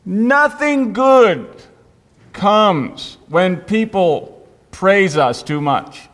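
very slow, weighty, every word leaned on; slow it right down and let each word land; preacher driving home the point, slow and heavy; solemn emphasis. Shown as a demonstration. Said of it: "solemn key statement".

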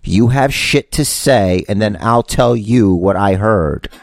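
slow, word-by-word explanation; deliberately slow. Shown as a demonstration. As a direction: spell it out slowly, as if for someone not following. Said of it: "try to say it slow for you".

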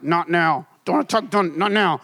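curt, irritated brush-off; retold grumpy not now; mild mock annoyance. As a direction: wave her off like a stressed husband. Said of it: "getting really mad".

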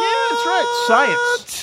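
high squeaky know-it-all; mock know-it-all. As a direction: squeak it out smugly. Mock smart boast.

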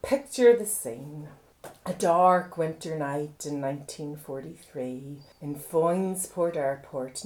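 a lightly voiced spooky storyteller; story narration. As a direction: draw them into a tale, softly. Storytelling origin of the drink.

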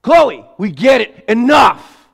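high, loud parent shouting enough; exasperated yell. Retold or imitated. Parent yelling back at angry daughter. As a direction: snap back at the stomping teen. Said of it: "I'm like".

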